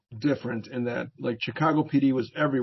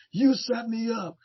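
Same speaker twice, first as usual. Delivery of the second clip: higher, slow and drawn out, voice changed; betrayed sergeant; 10 semitones higher, slower than usual. Retold or imitated. The sergeant accuses the teen, high and slow. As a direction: play the stunned sergeant, slowly accusing. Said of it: "the sergeant looked at me and goes".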